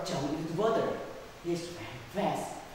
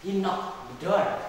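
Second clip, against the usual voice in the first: usual speaking voice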